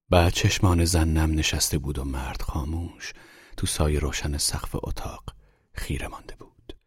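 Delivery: ordinary delivery, nothing marked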